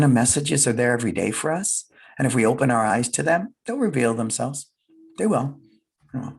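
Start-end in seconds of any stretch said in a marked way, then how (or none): none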